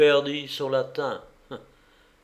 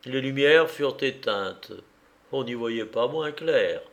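usual speaking voice

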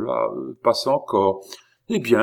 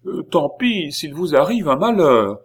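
loud, high-pitched angry warning; furious; 9 semitones higher, 5 dB louder than usual. A narrator's reading. Oliva turns on the stranger, furious. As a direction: wash your hands of him crossly. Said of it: "toute furieuse".

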